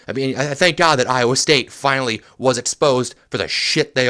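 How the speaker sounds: furious fan venting at the screen; angry rant. Real, not acted. Ranting about college football rankings.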